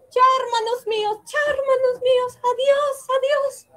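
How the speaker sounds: high, singing goodbye calls; happy farewell. Acted. Princess saying a cheerful goodbye to her brothers. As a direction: wave them off happily.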